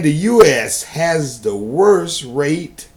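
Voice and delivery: ordinary talk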